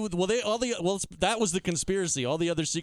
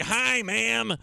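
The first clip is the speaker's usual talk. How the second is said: weird voice; 7 semitones higher, 3 dB louder than usual. Retold or imitated. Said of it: "he had a weird voice".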